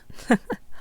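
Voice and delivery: short, breath-light chuckle; brief amused huff of laughter; soft chuckle. A narrator's reading. Elf amused at talk of husbands, soft chuckle. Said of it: "Lytheria chuckled".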